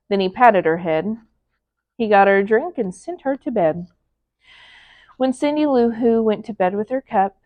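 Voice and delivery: ordinary delivery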